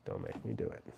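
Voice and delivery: very low, hushed warning; mock menace. Streamer warns a viewer he may block him, in low mock menace. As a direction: drop right down into a quiet playful threat. Mock threat repeated much lower.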